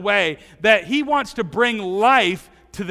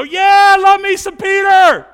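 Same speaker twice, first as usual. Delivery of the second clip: high, loud joyful whoop; delighted shout; 11 semitones higher, 13 dB louder, slower than usual. Real, not acted. Preacher cheering Peter's bold speech, high loud whoop. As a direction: whoop it out like a fan.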